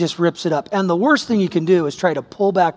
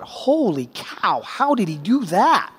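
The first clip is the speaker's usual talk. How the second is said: retold shock; 4 dB quieter, slower than usual. His shock seeing the lawn.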